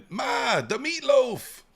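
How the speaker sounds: high, loud, drawn-out holler; hammed-up impression. Son hollering to Ma about meatloaf, high mock yell. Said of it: "I kinda do coach Fred".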